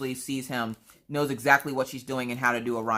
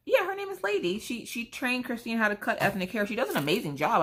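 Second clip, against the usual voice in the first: much higher, sing-song receptionist voice; chirpy salon clerk; 9 semitones higher than usual. Retold or imitated. Receptionist on the phone praising the stylist, much higher and chirpy. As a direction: play the cheerful phone receptionist.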